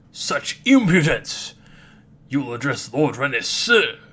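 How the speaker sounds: drawn-out furious shout; outraged. Acted. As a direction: bellow each word, drawn out in fury. Spirit outraged by insult.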